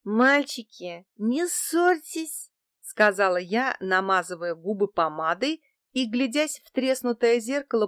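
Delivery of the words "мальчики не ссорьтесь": put-on slow, high sing-out to the boys; playful coaxing. A narrator's reading. Tipsy woman tells the quarrelling card players to stop, slow playful coaxing. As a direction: draw it out to soothe them.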